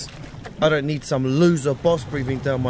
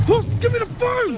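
desperate shout. Acted. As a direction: yell it, reaching for the phone. Boy grabbing for the phone.

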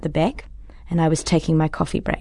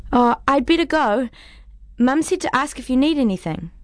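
put-on higher, polite little girl; young girl voice; 8 semitones higher than usual. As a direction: speak up like a polite kid. Neighbour child speaking politely.

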